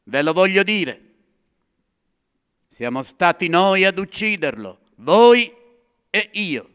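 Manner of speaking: high, loud accusation; accusing shout. Acted. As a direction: hurl the blame at them. Madman accuses the crowd.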